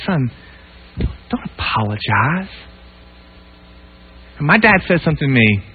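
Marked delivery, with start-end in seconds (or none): 0.0-2.5 tender reassurance. Retold or imitated. Dad comforting ashamed son.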